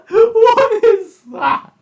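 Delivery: high, slow squeals of real laughter through the words; laugh-cry at something hideous; laughing in disbelief. Real, not acted. Viewer seeing a toy engine's ugly face, laughing in shock.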